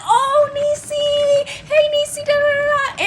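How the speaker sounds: high, drawn-out singsong hello; gushing high-pitched imitation; excited greeting. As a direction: imitate an uncle whooping at a relative. Uncle greets mom, acted.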